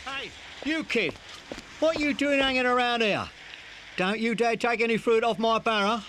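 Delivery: high-pitched, accusing holler; angry challenge. Acted. Barrow man warning a kid off his fruit, angry holler.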